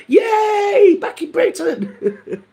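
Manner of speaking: high shouted yay fading into a laugh; relieved cheer. Retold or imitated. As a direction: cheer crossing the border home. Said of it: "We were like".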